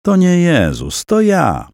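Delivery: female voice in his head. A narrator's reading. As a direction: sound like a woman speaking inside his head. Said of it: "Głos w jego głowie był kobiecy". (kobiecy głos w głowie Jakuba, dużo wyżej)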